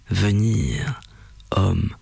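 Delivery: very low, fading to a whisper; a dying rumble from a beast; deep, breathy creature fading out. A narrator's reading. Monster's final halting words.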